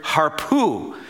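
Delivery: harpu said as HAR-poo, rolled-back r, falling end; harpu, two clear syllables, stress on HAR; Hebrew word harpu. Shown as a demonstration. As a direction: say harpu like harpoon without the n. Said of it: "I would say this word".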